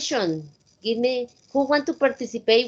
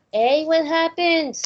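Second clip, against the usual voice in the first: teacher calling out; 5 semitones higher, 4 dB louder than usual. Shown as a demonstration. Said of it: "You say".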